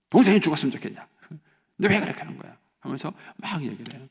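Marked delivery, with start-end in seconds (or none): 0.1-2.6 loud, raised-pitch telling-off; scolding parent. Acted. Parent scolding the older brother for his wish, angry scolding. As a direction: lay into the child with a sharp rise in volume. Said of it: "그럼 혼내야 될까요?".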